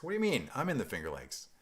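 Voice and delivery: high, loud, playful disbelief; joking outburst at a neighbour; mock indignation. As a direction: jump up in pitch as if affronted. Jokingly challenges viewer's hometown greeting.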